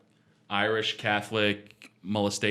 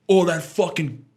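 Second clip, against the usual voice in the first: imitating dad; 6 semitones higher, 4 dB louder, slower than usual. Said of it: "He goes".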